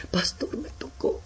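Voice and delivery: high, half-whispered, broken with tears; tearful convert. Retold or imitated. New convert telling the pastor he is saved, tearfully. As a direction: choke it out like a moved new believer. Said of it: "Y dice".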